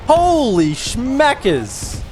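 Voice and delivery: slow, high, loud astonishment; surprised exclamation. Real, not acted. Seeing his army under attack, loud and astonished. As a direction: stretch out the shock.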